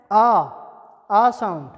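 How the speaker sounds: loud, long "आ"; "आ" called out long and open; long aa sound. Shown as a demonstration. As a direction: model "आ" as a big open aa. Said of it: "इसके बाद है".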